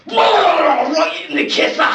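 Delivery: high, very loud, slow roar; explosive shout. Acted. Batman punching a punk out of nowhere, explosive shout. Sudden punch in acted fight.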